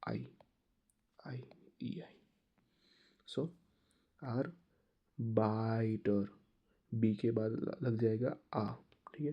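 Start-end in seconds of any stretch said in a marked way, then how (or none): 1.3-2.1 whispered letter sound; whispered vowel demo. Shown as a demonstration. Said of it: "ईआई वाला rule कौन सा लगेगा". 4.2-6.3 आर्बाइटर drawn out very slowly, "aar-bai-ter"; slowed teacher demo; slow German pronunciation. Shown as a demonstration. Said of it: "ईआई वाला rule कौन सा लगेगा".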